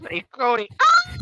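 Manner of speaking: high cry of pain on "ah"; pained yell. Retold or imitated. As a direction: cry out as if whipped. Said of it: "all you heard was".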